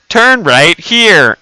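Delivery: loud, drawn-out navigation command; mock sat-nav giving firm directions, slowly; imitating GPS voice. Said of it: "and she's like".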